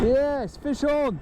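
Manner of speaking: high-pitched, elated call; excited cheer. Real, not acted. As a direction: call out the bite in triumph. Fish bites after long wait.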